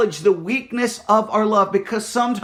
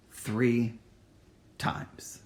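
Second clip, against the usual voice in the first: whispered repeat; 9 semitones lower, 10 dB quieter than usual. Acted. Repeated hushed count.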